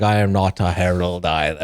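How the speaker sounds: low, lightly put-on godlike voice; Stormfather. Storm spirit answering Dalinar, low solemn role voice. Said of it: "I like how Stormfather just dances around".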